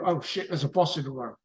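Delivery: ordinary delivery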